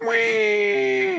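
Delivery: put-on high squealing baby cry; mock baby wail. Mocking a newborn sibling with a high wail.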